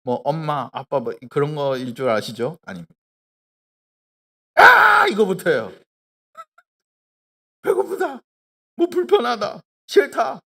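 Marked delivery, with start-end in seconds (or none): 4.6-5.1 put-on sudden high shrieking baby cry; loud imitated infant scream; baby's wail. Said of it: "가장 먼저 하는 말이 뭘까요". 7.6-10.4 high, fussy mock baby complaints; higher imitation of a fussing baby; whiny baby voice. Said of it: "가장 먼저 하는 말이 뭘까요".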